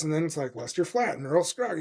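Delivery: normal talking voice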